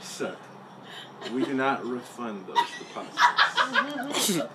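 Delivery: slow, over-precise official refusal; imitated clerk. Leasing agent refusing a refund, slow mock-official voice. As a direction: imitate a prim clerk laying down policy. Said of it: "And he goes".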